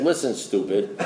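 lightly voiced blunt sponsor, low and soft; imitating an unruffled old-timer; gruff-talking sponsor. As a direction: quietly put them in their place, low-pitched. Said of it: "that's how my sponsor's talking".

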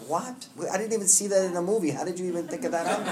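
a high, sudden "What?" then fast incredulous rambling; shocked disbelief. Retold or imitated. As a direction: react in amused disbelief at your own mind. Reacting to his own thoughts.

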